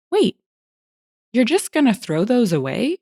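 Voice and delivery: high-pitched questioning customer; surprised question. A narrator's reading. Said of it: "he asks".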